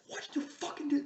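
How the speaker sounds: high-pitched mock outburst; sudden screamed demand, way up high. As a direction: imitate a guy suddenly going off on everybody. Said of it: "He's a guy who just starts screaming".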